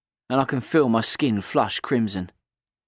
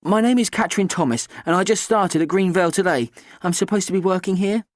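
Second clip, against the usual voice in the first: put-on higher, bright cheeky girl; 7 semitones higher than usual. A narrator's reading. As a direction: play the confident teenage girl. Kat voiced throughout.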